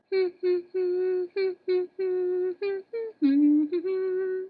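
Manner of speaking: high hummed tune; humming a song. A narrator's reading. Maid snips the treetop, humming to herself. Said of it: "while she hummed a song".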